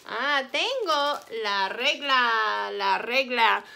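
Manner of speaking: "regla" with a trilled R at the start; rolled R. Shown as a demonstration. Teacher to class, modelling Spanish word, rolled R in "regla". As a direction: roll the R in "regla" strongly. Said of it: "Notice I'm rolling my R".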